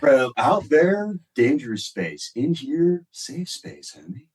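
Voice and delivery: dramatic announcer. Pretend.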